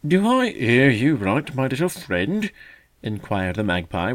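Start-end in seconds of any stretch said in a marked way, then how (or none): 0.1-2.5 slow, loud, drawn-out mock gravity; mock solemn. A narrator's reading. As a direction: pretend to be deeply serious. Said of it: "with mock solemnity".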